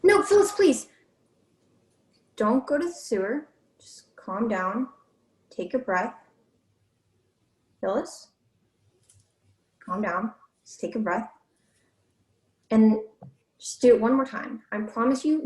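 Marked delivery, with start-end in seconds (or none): 0.0-0.9 high, loud plea to stay; pleading call. Real, not acted. Pleading as she heads off. 3.8-11.3 soothing. Real, not acted. Calming the upset actor.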